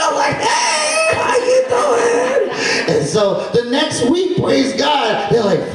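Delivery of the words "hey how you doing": very high, drawn-out squealed hello; acted excited greeting. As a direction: stretch out a hammed-up joyful greeting. He greets visitors back delightedly.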